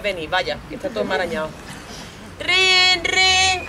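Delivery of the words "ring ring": high, loud sustained ring; imitated phone ring. Phone interrupting the couple's argument, voiced loud ringing. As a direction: mimic a telephone ringing loudly.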